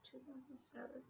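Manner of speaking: a soft whispered aside; whispering. Real, not acted. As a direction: breathe it almost silently.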